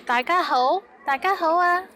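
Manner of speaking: high, loud greeting to a crowd; cheery group greeting. Shown as a demonstration. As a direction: greet everyone brightly. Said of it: "I will say".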